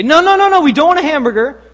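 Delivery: high, loud frantic objection; mock protest. Prank customers object to the cashier, loud mock outrage.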